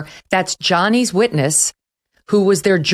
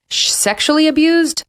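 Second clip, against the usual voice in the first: skeptical question; 11 semitones higher than usual. Real, not acted. Questioning her own claim.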